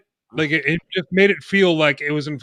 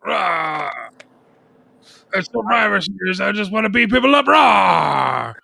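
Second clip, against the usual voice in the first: wrestler shout; 4 semitones higher than usual. Pretend. Joining the wrestler shouting bit.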